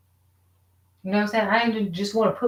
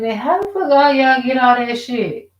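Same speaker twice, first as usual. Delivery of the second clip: higher, louder disbelieving outcry; exasperated outburst; 5 semitones higher, 6 dB louder than usual. Retold or imitated. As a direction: throw the question at the neighbours in frustration. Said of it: "I'm like".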